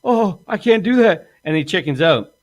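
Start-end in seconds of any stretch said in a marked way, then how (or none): 0.0-1.3 high, whiny backing-out voice; mock the friend chickening out; imitating the scared accomplice bailing; scared whine. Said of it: "he's like".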